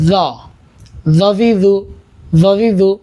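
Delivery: জ said with the tongue tip out between the teeth, like a soft th; জজিজু with the tongue between the teeth; soft th-like জ, tongue showing; tongue-out soft letter. Shown as a demonstration. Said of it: "জিহ্বাটা একটু বের করে নেব".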